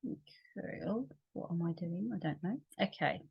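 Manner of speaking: very soft, under-the-breath aside; muttering to oneself. Real, not acted. Facilitator confused after the meditation, muttering to herself. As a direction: talk quietly to yourself, off to the side.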